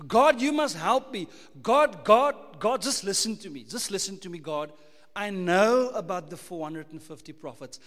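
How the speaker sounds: loud, insistent pleading to God; a self-centred prophet demanding to be heard; demanding plea. Acted.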